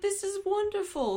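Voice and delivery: little British voice, pitched high; playful high British accent; Doug Bradley. Retold or imitated. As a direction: imitate a delighted British man, high-pitched. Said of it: "in his little British voice".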